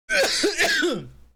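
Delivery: loud hacking cough; real choking cough fit; coughing up water. Real, not acted. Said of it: "My water went down the wrong pipe".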